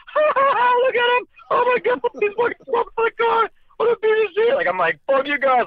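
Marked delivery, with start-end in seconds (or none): 0.1-4.5 high, jeering mock voices of laughing onlookers. Friends jeering at him slumped out of the car, high mocking voices. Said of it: "I can hear everybody like". 5.1-5.7 inner retort. Retold or imitated. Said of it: "like, in my brain".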